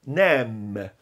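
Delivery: "Nem" low, with long vowel and hummed M; closed-lip M. Shown as a demonstration. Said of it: "junte os lábios pro som do M".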